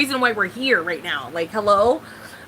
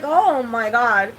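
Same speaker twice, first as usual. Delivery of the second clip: slow, drawn-out, exasperated sigh of words; drawn-out oh my God; much slower than usual. Retold or imitated. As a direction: stretch it out like you're done. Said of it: "I was like".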